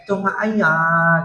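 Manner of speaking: slow, foreign-sounding mock mother; Chinese mama imitation. Mama scolding her son in a slow foreign lilt. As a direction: drag it out like an old Chinese mum.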